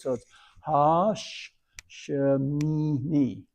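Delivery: Shemini spelled out slowly, syllable by syllable; sounding out Shemini. Shown as a demonstration. Teacher sounding out Shemini, syllable by syllable. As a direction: drag out Ha, Sh, Shemini. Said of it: "So now the next syllable is Shin".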